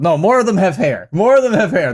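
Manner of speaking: normal talking voice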